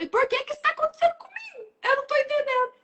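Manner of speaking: high, fast, pleading self-pity voice; whiny complainer. Retold or imitated. A person who does everything right, protesting to God, in a high whine. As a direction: play the good person whining at fate. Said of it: "Tem gente que fala".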